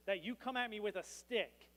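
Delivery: ordinary talk, nothing marked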